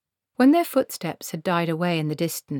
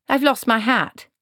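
a hint of a put-out old woman, higher; lightly voiced old witch; 7 semitones higher, 6 dB louder than usual. Granny fishing for her hat, mildly put out. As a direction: complain about the hat.

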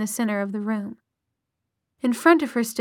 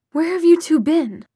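louder, higher demand; sharp, raised question; stern questioning; 6 semitones higher, 6 dB louder than usual. A narrator's reading. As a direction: confront them like a cross mother. Mother confronts her children.

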